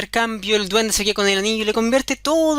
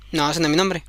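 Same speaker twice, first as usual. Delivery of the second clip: low, smug refusal; the goblin rejecting the queen's guess; 7 semitones lower than usual. Acted. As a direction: answer low and unbothered.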